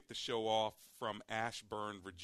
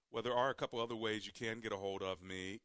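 ordinary delivery

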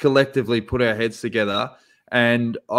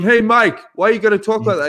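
higher, louder mock interviewer voice; lightly voiced cheeky reporter; interviewer asking; 8 semitones higher, 4 dB louder than usual. Reporter pressing Mike Tyson about how he talks, higher, louder. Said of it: "He's going".

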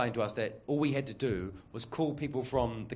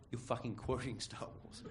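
much softer, flat muttered aside; deadpan under-breath; 12 dB quieter than usual. Retold or imitated. Employee muttering at boss quoting Yoda, much softer. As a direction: mutter it, unimpressed.

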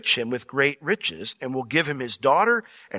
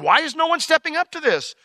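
high-pitched puzzled question; baffled; 11 semitones higher than usual. Acted. David wondering at the idle soldiers, baffled. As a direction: ask it in honest bewilderment. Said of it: "because he doesn't understand".

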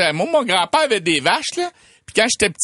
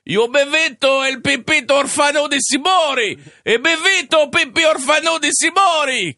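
Italian ghost cry; 9 semitones higher than usual. Acted. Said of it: "on peut encore entendre crier".

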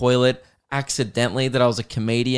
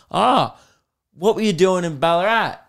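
higher-pitched, keenly curious bloke; nosy pub bloke; 5 semitones higher than usual. Retold or imitated. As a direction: imitate an over-interested stranger in a pub. Voices the nosy man in toilet.

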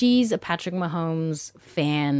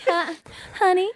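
flirty voice; 11 semitones higher than usual. Acted. Voice to husband.

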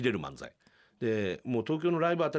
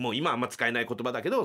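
normal talking voice

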